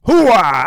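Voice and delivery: loud barked hooah; Al Pacino hooah. Retold or imitated. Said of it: "Al Pacino here".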